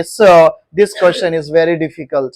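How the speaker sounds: slow, deliberate teacher demo; slow classroom demonstration of a polite line; slow model sentence. Shown as a demonstration. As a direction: spell it out slowly for the class. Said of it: "तो आप बोलोगे".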